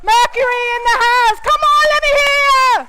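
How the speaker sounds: loud, high club DJ hyping the crowd; DJ hype. Acted. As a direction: whip the crowd up like a club DJ, drawing out Dublin.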